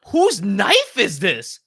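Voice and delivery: slow, high, loud alarmed question; alarmed demand. Retold or imitated. Airport staff to traveler, slow and alarmed. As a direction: ask like airport staff who just found a blade. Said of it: "these people were freaking out".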